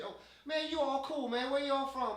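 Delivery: excited approval. Acted. Homeless man warming to the outreach workers, excited approval. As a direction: gush at your new friends.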